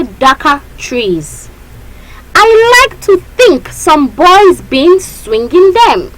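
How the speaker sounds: high, loud, slow poem reading; class recitation. Shown as a demonstration. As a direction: lead the students through the verse. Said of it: "recite with me".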